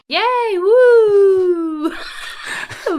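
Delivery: loud excited cheer. Real, not acted. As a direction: celebrate the winner loudly. Celebrating giveaway winner.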